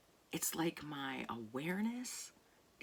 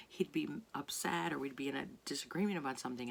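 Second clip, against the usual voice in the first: normal talking voice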